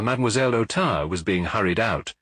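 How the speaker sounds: usual speaking voice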